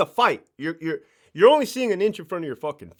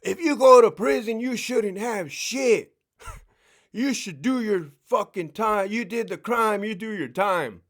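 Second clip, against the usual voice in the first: hard-line guard voice; 4 semitones higher than usual. Retold or imitated. Ex-officer mocking the hard-line guard mindset, higher and mock stern. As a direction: play the strict guard he no longer is. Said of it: "My mindset is not this".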